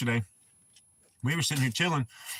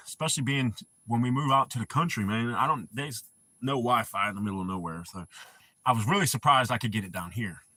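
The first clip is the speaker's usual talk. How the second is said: ordinary delivery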